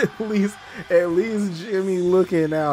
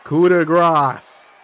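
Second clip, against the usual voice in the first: coup de grace called slow and loud; drawn-out announcer hype; hyped call; 5 semitones higher, 7 dB louder, much slower than usual. Real, not acted. Hyping a finisher move.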